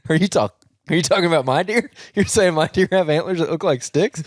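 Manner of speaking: mock incredulous. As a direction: question it as if playfully offended. Mock offence about his deer.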